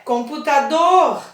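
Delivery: "computador" with the last syllable pushed harder; "computador", rising into a forceful final "dor"; stressed final syllable. Shown as a demonstration. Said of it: "falar um pouquinho com mais força".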